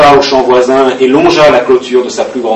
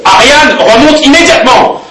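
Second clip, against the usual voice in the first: high, loud order; stern command; 10 semitones higher, 4 dB louder than usual. Retold or imitated. Stepmother ordering Ariane back upstairs, stern. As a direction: call her back up the stairs sternly. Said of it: "elle me rappelle".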